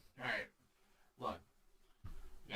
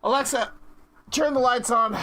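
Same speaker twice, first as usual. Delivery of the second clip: loud command; 8 semitones higher, 7 dB louder than usual. Real, not acted. Calling out command to Alexa.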